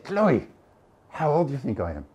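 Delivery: high-pitched imitation of a cheeky old lady; cheeky little old lady. Little lady quizzing the lecturer after his talk, cheeky and high. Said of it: "cheeky, cheeky, absolutely lovely".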